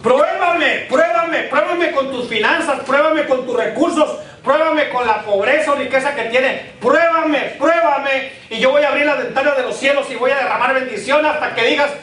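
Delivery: high, urgent preacher quoting God; fervent preaching. Acted. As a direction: proclaim the challenge to the congregation. Preacher voices God's challenge from Scripture.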